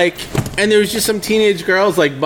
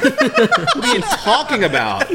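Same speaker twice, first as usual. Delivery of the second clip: mock exasperated; 7 semitones higher, slower than usual. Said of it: "I'm like".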